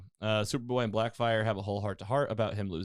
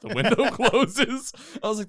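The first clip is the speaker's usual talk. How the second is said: real laughing; 11 semitones higher, 9 dB louder than usual. Real, not acted. Host telling friends the window closes, cracking up. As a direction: laugh your way through the words.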